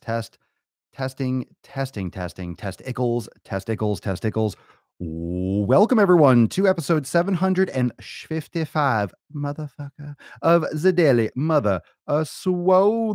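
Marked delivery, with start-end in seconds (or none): 5.0-13.1 loud, high pumped-up show intro; hype announcer. Acted. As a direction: belt it like a hype man opening the show.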